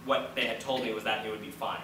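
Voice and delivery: ordinary talk, nothing marked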